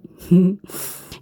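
dark rising laugh; wicked laugh. Acted. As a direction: laugh like a demon savoring a trap. Succubus laughs after trapping.

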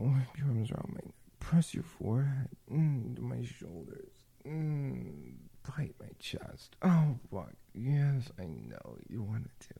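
ordinary delivery

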